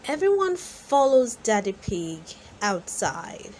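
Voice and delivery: ordinary delivery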